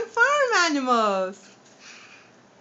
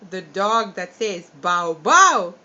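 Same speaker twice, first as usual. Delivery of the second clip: slow, clear teaching; 10 semitones lower, 5 dB louder, slower than usual. Shown as a demonstration. Teacher names dog sound slowly.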